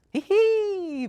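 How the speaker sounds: put-on shrill loud little-girl laugh; high giggle. A narrator's reading. Bella running into the snowy garden, loud high giggle. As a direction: burst out giggling as she runs. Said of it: "Bella laughed".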